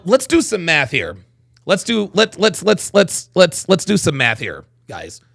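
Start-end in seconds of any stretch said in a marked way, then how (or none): none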